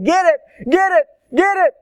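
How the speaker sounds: high, loud repeated cry, imitating a panicked onlooker; mock-panicked shouting, rising each time; frantic urging. Onlooker urging man to kill the snake, high frantic yelling.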